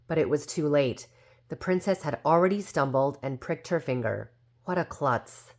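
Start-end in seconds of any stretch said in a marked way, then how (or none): none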